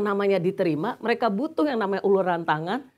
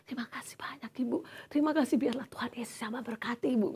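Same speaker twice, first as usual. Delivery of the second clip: quick voiceless whisper of thanks; 3 semitones higher, 9 dB quieter, faster than usual. Retold or imitated. Aunt thanking the woman on the phone in a whisper. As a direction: hurry the thanks out in a bare whisper.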